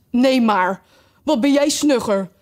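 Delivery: scornful retort with a touch of anger; angry. A narrator's reading. Mock turtle snubbing Alice's silly question, angry. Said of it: "zei de nepschildpad boos".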